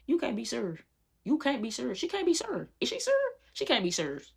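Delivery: high-pitched incredulous squeak; mock disbelief. Narrator gawking at her friend's tantrum, mock incredulous.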